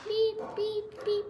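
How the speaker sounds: high, evenly spaced beeps; imitated beeping. Child playing at a beeping noise, high-pitched imitation. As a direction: beep like a little machine, high and steady.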